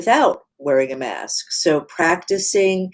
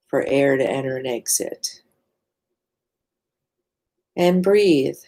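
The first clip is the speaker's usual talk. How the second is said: guided meditation. Real, not acted. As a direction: lead listeners into body awareness, leaving space between phrases. Leads a mindfulness practice.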